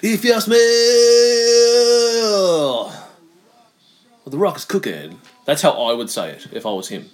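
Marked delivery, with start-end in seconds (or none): 0.0-5.2 big wrestler catchphrase voice; hammed-up wrestling promo delivery; The Rock impression. Shown as a demonstration. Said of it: "I can do a good Rock impression".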